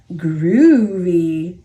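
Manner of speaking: a lightly voiced laid-back cat; a hint of a mellow, unbothered cat; cool cat. A narrator's reading. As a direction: say it low and easy like a cool cat. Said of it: "says Pete".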